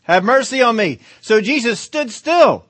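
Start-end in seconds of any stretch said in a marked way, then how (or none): 0.0-1.0 loud pleading cry. Acted. Bartimaeus cries louder to the Son of David, loud. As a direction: call out desperately. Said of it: "he cried out all the more".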